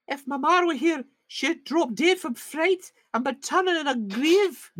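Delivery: very high, slow, fearful Scottish ramble; fearful young Scottish. Acted. Maid afraid of what she'll discover, high Scottish voice. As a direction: ramble on in a high frightened Scots voice. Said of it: "fearfully rambling on".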